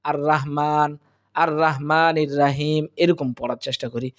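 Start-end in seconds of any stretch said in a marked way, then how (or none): 0.1-2.8 "আর রহমানির রাহিম" with a light, plain h and thin r; wrong pronunciation. Shown as a demonstration. Teacher showing students a common recitation mistake, incorrect light pronunciation. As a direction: say "আর রহমানির রাহিম" casually, without heavy Arabic letters. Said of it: "কিন্তু এটা সম্পূর্ণটাই ভুল".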